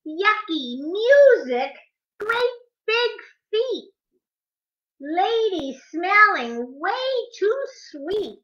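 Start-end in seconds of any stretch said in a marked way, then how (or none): none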